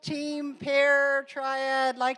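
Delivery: real teacher's call for volunteers, high and stretched. Real, not acted. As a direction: project slowly over the group to get attention. Calling the whole room back.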